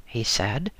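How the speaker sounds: usual speaking voice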